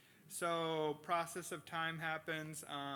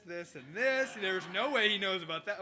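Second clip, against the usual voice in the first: louder, rushing worry over what dad found out; mock dread, listing every misdeed; inner panic; 4 semitones higher, 9 dB louder than usual. Teenage son fearing dad's summons, half-joking panic. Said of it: "And I'm like".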